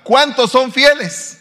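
¿cuántos son fieles? called out high, loud and slow; loud, slow call to the congregation. Real, not acted. Preacher calling to the congregation, loud, high and slow. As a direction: demand an answer from the congregation. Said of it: "voy a preguntar".

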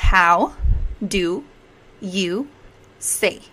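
each word set apart by pauses. Shown as a demonstration. Teacher breaks the question down for learners, word by word. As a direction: dictate it one word at a time.

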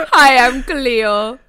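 slow, loud put-on island accent; Miss Cleo. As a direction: draw it out in a hammed-up island accent. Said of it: "she fucking faked the accent".

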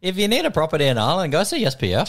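mock Irish ad read. Cohost reading the sponsor in mock Irish. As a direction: sell it like an Irish ad. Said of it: "you got me doing it now".

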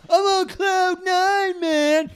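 slow high stoner drawl; hammed-up stoned slur. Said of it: "He's like".

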